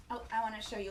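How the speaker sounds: ordinary delivery, nothing marked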